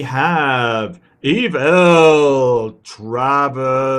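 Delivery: slow, loud drawn-out announcer call; fighting game announcer. Pretend. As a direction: boom out each name like a fight intro. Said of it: "I gotta get out my, uh, announcer voice".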